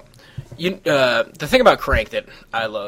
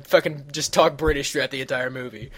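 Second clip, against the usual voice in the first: ordinary talk, nothing marked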